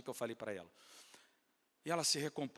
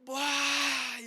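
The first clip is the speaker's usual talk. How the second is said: long high cry of shock; a loud, drawn-out wail as if collapsing; startled wail; 10 semitones higher, 7 dB louder than usual. Retold or imitated. Acting out the woman's collapse. (grito longo de choque)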